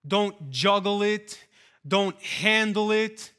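preacher's slow rising exhortation; preaching at full force. Acted. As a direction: hammer each command home slowly. Building list of commands.

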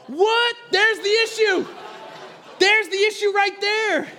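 high, loud, incredulous outburst; outraged shout. Retold or imitated. Father reacting to his toddler's cheek, shouting incredulously. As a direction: shout it in mock shock.

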